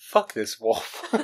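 slow words through laughter; laughing. Real, not acted. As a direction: say it while cracking up. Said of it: "laughing at the howl".

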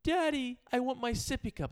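put-on high-pitched child's demand; imitated little daughter. Daughter calling daddy back as he leaves, childlike demand. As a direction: pipe up like a small kid. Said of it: "She goes".

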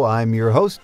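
normal talking voice

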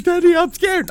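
high whiny child voice imitating a kid; scared little kid. Son on the ride, high scared kid voice. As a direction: play the frightened toddler clinging on. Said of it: "He's like".

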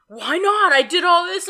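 high, loud mock complaint; indignant lover, re-enacted; retold yelling. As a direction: whine loudly in imitated frustration. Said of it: "he was yelling at me".

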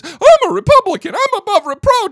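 high, loud sneering mimic of a smug man; shrill up the pitch and mock his self-righteous boast; hammed-up mockery of a pompous politician; mocking Comey voice.

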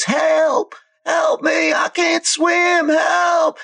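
high, shrill cries of a drowning woman; woman screaming for help. Acted. Game master voicing a drowning woman, high screaming. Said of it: "clearly a female voice".

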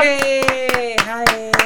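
excited greeting. Real, not acted. Guest greets in high voice.

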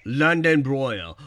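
put-on slow, loud posh London accent. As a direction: stretch it out like a Londoner. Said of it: "I can't even do a London accent".

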